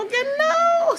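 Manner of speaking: very high, drawn-out squeal; playful refusal. Real, not acted. Vlogger refusing to do this video again, high playful squeal. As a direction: shoot the voice way up in mock protest.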